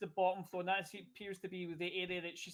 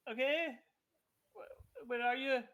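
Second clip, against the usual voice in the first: character voice; 5 semitones higher, slower than usual. Acted. NPC voiced by GM.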